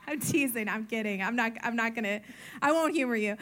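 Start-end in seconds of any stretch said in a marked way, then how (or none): none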